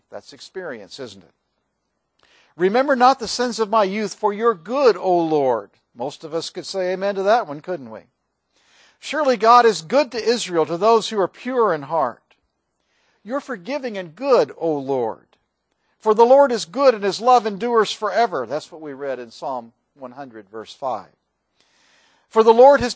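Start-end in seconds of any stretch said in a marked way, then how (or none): 2.6-5.7 louder, solemn reading of scripture; lifted, reverent reading voice; psalm reading. Acted. 9.0-18.5 louder, proclaiming scripture reading; solemn scripture proclamation; psalm reading. Acted.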